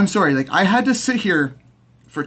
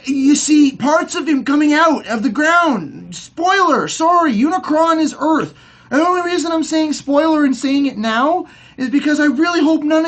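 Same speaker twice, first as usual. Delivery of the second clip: fired-up fan ranting about the movie; worked up rant; 8 semitones higher than usual. Real, not acted. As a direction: vent it, worked up and incredulous. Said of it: "I'm mad".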